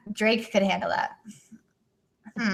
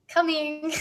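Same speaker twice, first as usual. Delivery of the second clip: attempted Scottish accent; 8 semitones higher than usual. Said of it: "I don't know how to do Scottish".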